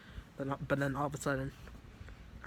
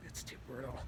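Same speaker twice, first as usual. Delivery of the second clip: much softer, fading out; faint dying murmur; 10 dB quieter than usual. Retold or imitated. Wounded friend murmurs faintly from the car. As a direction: let the words barely come out. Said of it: "just talking like that".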